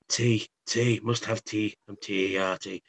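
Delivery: low, soft hungover mumble; hungover craving. Retold or imitated. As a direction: act barely human, craving a cup of tea. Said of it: "this is how hungover I was".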